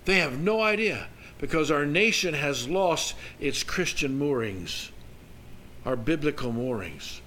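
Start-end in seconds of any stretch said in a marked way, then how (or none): none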